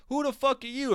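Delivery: high, incredulous reporter voice; mock reporter. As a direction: put on a sneering journalist's disbelief. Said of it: "Some even asked him at the press conference".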